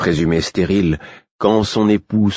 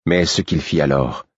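ordinary delivery

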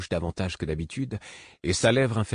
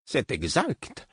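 slow, higher, teasing reply; slightly mocking; 7 semitones higher, much slower than usual. A narrator's reading. Imbre teases the narrator's childish protest, slow and mocking. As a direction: let a little mockery curl through it. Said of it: "d'un air un peu narquois".

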